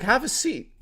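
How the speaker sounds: a hint of an Italian dad's accent. Her dad offers a seat, light Italian accent. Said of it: "he talked like an Italian man".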